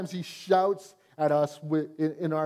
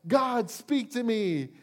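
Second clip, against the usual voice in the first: crying out; 6 semitones higher, slower than usual. Retold or imitated. Person crying out to God.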